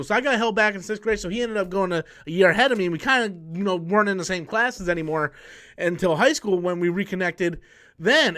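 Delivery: ordinary talk, nothing marked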